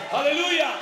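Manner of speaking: loud hallelujah; triumphant preacher's cry; joyful shout. Acted. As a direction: shout it out in joy. Preacher exclaiming.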